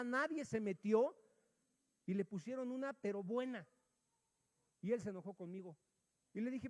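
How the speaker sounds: usual speaking voice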